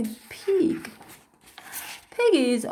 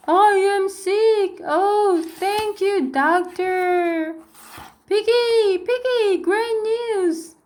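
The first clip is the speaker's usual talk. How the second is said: higher and louder, bursting with relief; overjoyed; 7 semitones higher, 3 dB louder than usual. Acted. Gerald calling to Piggy, thrilled that it is only a cold. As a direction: announce it like the best news ever.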